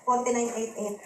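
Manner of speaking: low, softened, hesitant admission; sheepish husband. Acted. Husband admits the price to his wife, low and sheepish. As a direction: own up sheepishly, voice dropped. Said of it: "napakamot ng ulo si Brian".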